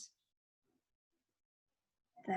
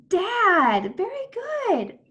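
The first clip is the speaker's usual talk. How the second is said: high, stretched-out cheer; excited praise; 9 semitones higher, 4 dB louder, much slower than usual. Pretend. Teacher praises child's answer, excited and high. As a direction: sing out the praise brightly.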